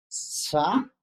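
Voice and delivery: "Sa" with a long hissed S before the vowel; fricative demo. Shown as a demonstration. Teacher demonstrating a fricative to students, hissing friction. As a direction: draw out the friction in "Sa". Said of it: "una fricción".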